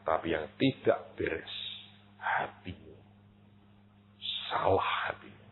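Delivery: soft, low, slow verdict; quiet rebuke. Retold or imitated. God rebuking the pastor, soft and low. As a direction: lay the rebuke down gently but firmly.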